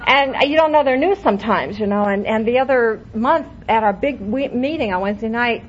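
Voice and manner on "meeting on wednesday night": ordinary talk, nothing marked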